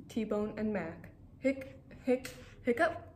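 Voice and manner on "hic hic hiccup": hiccups. Acted. Clifford hiccups outside.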